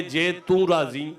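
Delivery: normal talking voice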